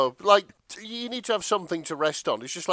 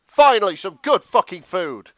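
mock Ramsay shout; 3 semitones higher, 11 dB louder than usual. Reader doing Ramsay's catchphrase as a loud shout. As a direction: bellow it like a chef finally fed. Gordon Ramsay quote read out.

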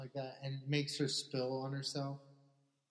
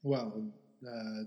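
usual speaking voice

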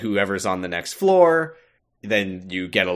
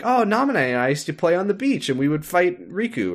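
Sora voice; 9 semitones higher than usual. Retold or imitated.

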